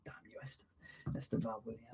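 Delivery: playful whispered curse; whispering. Pretend. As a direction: hiss it under your breath. Mock curse at commenter.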